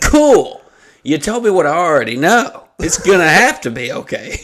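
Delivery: high, loud mock sarcasm; sarcastic retort. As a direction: throw the obvious back at him.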